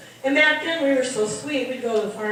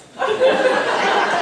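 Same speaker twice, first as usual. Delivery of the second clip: high hooting laugh, real; bursting out laughing; big laugh; 14 semitones higher, 6 dB louder than usual. Real, not acted. Comedian to the audience, laughing high and loud. Comedian cracks up at own story.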